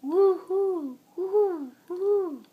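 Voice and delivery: gentle owl woo-hoo; owl hoot. Acted. Child imitating an owl.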